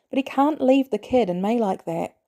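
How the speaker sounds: usual speaking voice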